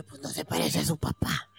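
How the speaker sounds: hushed, near-whispered thought to self; quiet inner doubt, barely voiced; private thought. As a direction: say it under your breath, puzzled. Retold puzzled thought to self.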